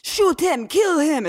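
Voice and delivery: high, loud villain voice; hammed-up Soviet minister barking orders; cartoon Russian villain. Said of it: "talking like that".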